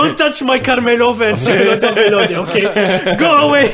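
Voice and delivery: loud, high, laughing tirade; mock shouting. Vendor chases man off his ice cream cart, mock shouting. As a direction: yell them away from the cart, half laughing.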